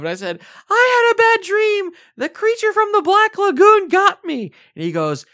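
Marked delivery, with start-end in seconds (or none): 0.7-4.5 put-on much higher, louder little-boy voice; scared little kid. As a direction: whimper it out like a frightened small child. Scared kid answering angry dad.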